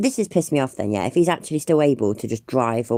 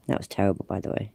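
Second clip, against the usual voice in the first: normal talking voice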